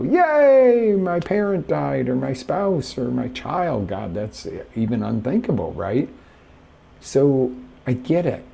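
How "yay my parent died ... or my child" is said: a high, loud, falsely happy cheer that fades; sarcastic mock glee at terrible news; mock cheerful. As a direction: cheer absurdly about a loss.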